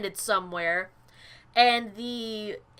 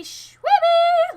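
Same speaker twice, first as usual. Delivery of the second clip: sudden very high, loud squeal of the name; squeaky name call; 9 dB louder than usual. Acted. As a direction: sing out your channel name way up high. Announcing his own channel name.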